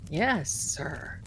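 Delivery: acted-out quiet, dutiful reply; meek phone reply. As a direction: answer softly and low, as if obeying. Her answer in acted call.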